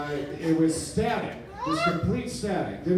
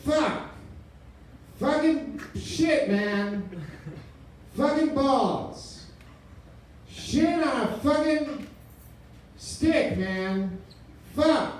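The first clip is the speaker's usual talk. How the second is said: high, drawn-out despairing curses; exasperated cursing; 9 semitones higher, much slower than usual. Retold or imitated. Caller curses the old PowerBook, high and drawn out. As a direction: swear in high-pitched frustration.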